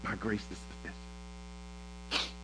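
God's promise, sinking from quiet to breathless whisper; preacher voicing God's comfort to the weary, fading into a whisper; soft voice of God, fading to a whisper. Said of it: "And God is saying".

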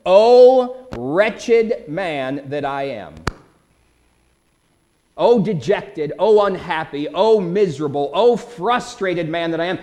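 loud, drawn-out lament; lifted voice. Acted. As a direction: cry it out like a wretched, frustrated man. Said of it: "lifts up his voice and says".

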